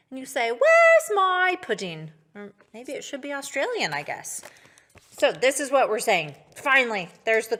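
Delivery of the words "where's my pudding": put-on slow, higher, proper British accent. Animals asking Farmer Bill, proper British accent. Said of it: "a proper British accent".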